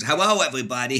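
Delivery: little Elmer Fudd-style dog; lisping cartoon Elmer Fudd greeting, w for l; Leon. Little dog greeting everybody, Elmer Fudd voice. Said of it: "he talked like".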